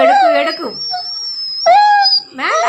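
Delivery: very high sing-song taunt; taunting singsong. Real, not acted. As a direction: chant it up high to tease. Mother taunting child about cat.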